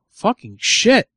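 normal talking voice

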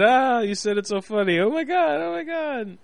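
high, teasing falsetto echo; mocking wife impression. Husband teasing his wife about what she said, mocking high voice. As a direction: make fun of her in a squeaky pitch. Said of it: "to make fun of her".

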